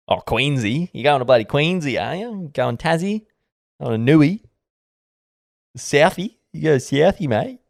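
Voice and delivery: mock broad Aussie bloke; ocker Aussie. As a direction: ham up a true-blue ocker bloke.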